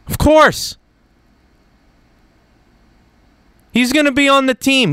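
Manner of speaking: insisting it's obvious; emphatic certainty. Real, not acted. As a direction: state the obvious with force.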